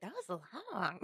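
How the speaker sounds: lightly acted, understated grumble; imitated moviegoer reaction. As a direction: quietly mimic the departing audience. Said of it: "but they were like".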